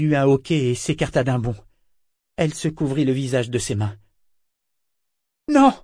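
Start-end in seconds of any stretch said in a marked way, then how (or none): 5.5-5.7 sharp shocked cry of no. A narrator's reading. Malta recoiling from her fiancé, crying out. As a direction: cry it out as she flees. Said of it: "cria-t-elle".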